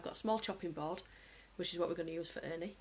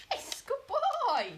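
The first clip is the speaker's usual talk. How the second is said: excited praise; 4 dB louder, much slower than usual. Real, not acted. Owner praising her dog for digging, high and slow. Dog finds the hidden treat.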